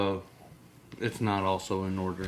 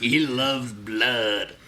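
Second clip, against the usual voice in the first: very slow menacing voice; much slower than usual. Acted. Slow mock voice.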